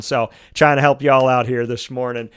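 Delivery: usual speaking voice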